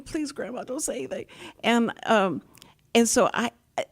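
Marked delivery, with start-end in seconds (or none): 0.0-1.2 softer, higher begging grandma; hushed pleading. Retold or imitated. Grandkids quietly begging grandma not to speak up. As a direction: plead quietly so nobody hears. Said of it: "they'd say".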